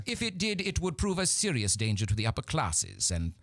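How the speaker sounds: high, haughty British matriarch lecturing; stately Victorian dowager holding forth; Lady Bracknell. Acted. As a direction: pronounce with grand disdain. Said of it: "says Lady Bracknell".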